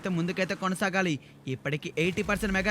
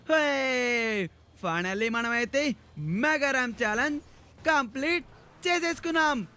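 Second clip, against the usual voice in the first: excited victory announcement ending in a whoop; drawn-out, jubilant game-host cheer; triumphant cheer; 4 semitones higher than usual. Acted. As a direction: celebrate a win, higher as it builds. Celebrates finishing the challenge.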